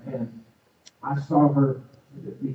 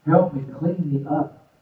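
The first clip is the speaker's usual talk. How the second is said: higher, louder pleading prayer; fervent prayer; 4 semitones higher, 3 dB louder than usual. Retold or imitated. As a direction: cry out to the Lord for help. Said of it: "I'm praying to the Holy Ghost".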